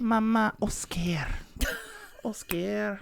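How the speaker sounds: put-on high, calling child's voice with a Spanish accent. Little girl calling her mama and Oscar in a Spanish accent. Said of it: "talked like she was Spanish".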